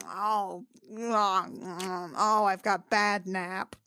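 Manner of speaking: ordinary delivery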